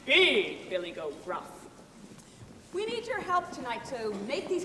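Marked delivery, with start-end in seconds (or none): 0.1-1.5 1 big goat voice. Acted. Big goat voiced deep, slow.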